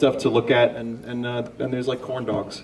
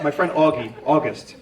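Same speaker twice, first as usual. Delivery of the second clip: ordinary talk, nothing marked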